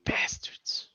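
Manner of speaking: hushed whispered curse; whispering. Acted. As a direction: whisper it under your breath.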